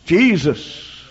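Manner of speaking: ordinary delivery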